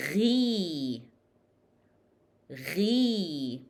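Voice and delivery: "ghi" with rasped gh and long ee; gargled "ghi", vowel held; ghain with kasra. Shown as a demonstration. Teacher shows ghin with kasra, long ghi. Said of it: "Ghin with kasra".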